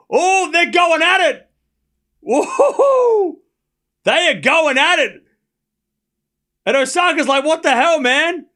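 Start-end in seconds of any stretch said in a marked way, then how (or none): 0.1-5.2 high, drawn-out excited whooping; thrilled disbelief. Real, not acted. Reacts live to players arguing. 7.4-8.5 high-pitched mock bewilderment; imitated indignant disbelief. Acts out Osaka's stunned reaction.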